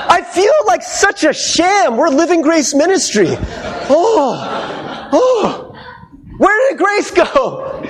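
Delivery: high, slow, hammed-up shock; mock dismay. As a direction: wail it like the word vanished. Mock dismay at tiny word.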